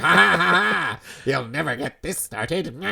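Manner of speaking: cackling demon taunt. Acted. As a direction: sneer it like a cartoon demon. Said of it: "That's my demon voice".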